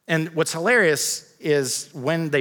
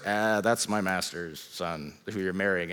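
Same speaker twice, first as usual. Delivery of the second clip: servant's reply; 7 semitones lower, 6 dB quieter than usual. Retold or imitated. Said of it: "the servant's like".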